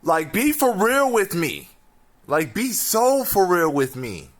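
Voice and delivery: high, loud disbelief; exasperated shout. Real, not acted. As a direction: yell it in total disbelief. Outraged rant at audience.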